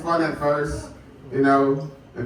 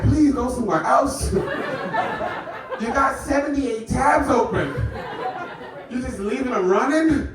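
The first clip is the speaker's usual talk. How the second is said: annoyed laptop; 8 semitones higher than usual. Pretend. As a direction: plead like an overworked laptop. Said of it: "My laptop's like".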